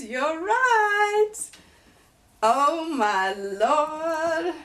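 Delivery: high, loud, then drawn out and sung; thrilled squeal. Real, not acted. Telling viewers they guessed right, thrilled and sung. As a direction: squeal it, then sing it out slowly.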